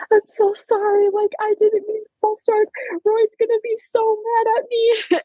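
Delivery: very high, whimpering mock sob; crying. Athlete apologising to coaches for false start, crying. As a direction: retell a tearful, desperate apology. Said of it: "crying and crying. And I'm just like".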